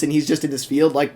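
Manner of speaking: ordinary talk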